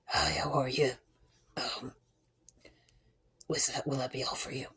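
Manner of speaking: sick quiet voice. Retold or imitated. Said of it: "I just wait it out".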